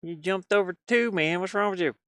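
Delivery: ordinary delivery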